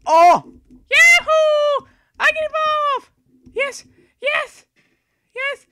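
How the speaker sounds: real triumphant shrieks of yes; ecstatic yells. Real, not acted. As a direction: whoop with joy. Finally able to evolve.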